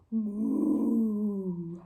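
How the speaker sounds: low, soft, long moo; cow moo. Acted. Presenter making the cow's sound for children, soft moo. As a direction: imitate a cow lowing gently. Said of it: "it's the cow".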